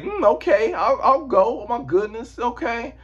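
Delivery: high falsetto impression of a woman; mock Layla voice. Layla agreeing to go, high mock female voice. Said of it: "meanwhile, she's like".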